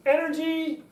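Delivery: high, lifted word of energy; lively high note; energetic. Shown as a demonstration. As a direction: send the pitch up with pep. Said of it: "your voice goes up a little bit in pitch".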